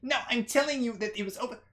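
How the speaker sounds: high, hurried insistence; scolding small woman. Acted. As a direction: argue back quickly in a high voice. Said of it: "And she's like".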